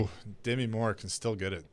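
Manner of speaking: hushed aside under the breath; inside voice. Real, not acted. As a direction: slip it in quietly, half to yourself. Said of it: "that was my inside voice".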